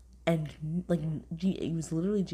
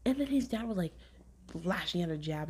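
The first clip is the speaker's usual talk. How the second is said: ordinary talk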